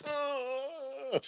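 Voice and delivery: a soft, high, deflated ugh; dismayed ugh. Retold or imitated. To himself about the grits, a soft high groan. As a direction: sink inside at the general's answer. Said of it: "And I went".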